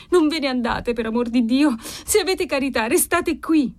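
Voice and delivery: frightened daughter imploring; desperate pleading. Acted. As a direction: beg anxiously, rising in pitch as you go. Frightened girl begs father to stay.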